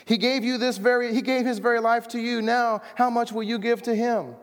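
high, pleading mock preacher appeal; mock preacher plea. Mocking a familiar pulpit appeal.

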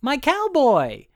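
put-on high, loud, drawn-out child's cry; acted Bonnie, delighted. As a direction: squeal it slowly like a kid spotting a toy. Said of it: "Bonnie goes over to the box and goes".

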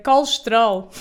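colesterol squeezed to kolʃtɾol; Portuguese colesterol. Shown as a demonstration. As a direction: swallow the vowels in colesterol. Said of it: "que é".

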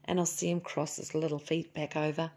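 ordinary delivery

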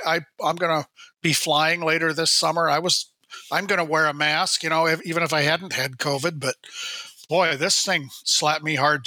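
ordinary talk

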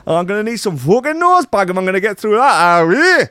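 put-on loud, high-pitched comic Brazilian accent; over-the-top Brazilian accent, squawked high. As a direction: shout it in a hammed-up Brazilian jiu-jitsu voice. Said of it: "oh, can I do a Brazilian accent?".

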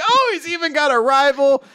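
high, thrilled fatherly exclaim; a proud dad amazed by good news; delighted dad. Retold or imitated. Dad thrilled to learn his son has a rival, pitched high.

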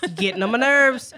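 a hammed-up annoyed spouse; mock warning. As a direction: play at being annoyed, half-joking. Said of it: "there'll be times when I'll be like".

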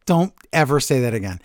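normal talking voice

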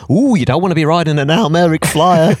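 smug advice-giver voice; busybody with a knowing ooh; know-it-all impression. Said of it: "who you've done a great impersonation of".

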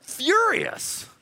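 comic indignation; furious. Real, not acted. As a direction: spit the word out, outraged. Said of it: "And I'm like".

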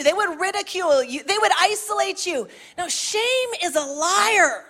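rising, impassioned pulpit preaching; preaching at full force. Acted. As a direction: preach it hard to the congregation.

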